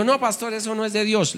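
high, soft mock-pious objection; pious excuse. Late brother excusing himself to the pastor, high and soft. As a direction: protest in a lightly mimicked churchy voice. Pastor voices church member.

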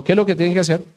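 usual speaking voice

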